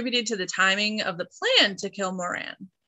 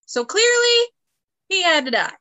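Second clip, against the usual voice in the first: mock dramatic verdict; 10 semitones higher, 6 dB louder than usual.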